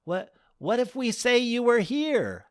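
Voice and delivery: a groom puzzled by the goddesses; baffled groom. Acted. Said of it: "asked Dale".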